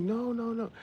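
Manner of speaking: high-pitched imitation of a woman saying no; high mock refusal. Woman refuses just one kiss, high mock protest.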